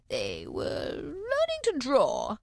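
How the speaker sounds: slow, sleepy yawning voice; drowsy, barely awake. A narrator's reading. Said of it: "yawning and rubbing its eyes".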